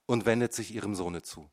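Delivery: ordinary delivery, nothing marked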